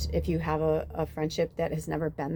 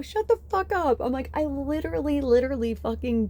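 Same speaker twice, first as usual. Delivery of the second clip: excited disbelief; 7 semitones higher than usual. Retold or imitated. Both shocked at same time.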